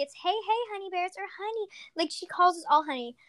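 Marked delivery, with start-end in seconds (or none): 0.1-1.1 higher, softer greeting in a mimicked voice; a light imitation of a sweet friend; Rosie. People mimicking the friend who calls everyone honey bears, lightly imitated. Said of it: "when people make an impression of Rosie". 1.4-1.7 high, soft pet-name imitation; Rosie. Mimicking the friend who calls everyone honey, high and soft. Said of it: "when people make an impression of Rosie".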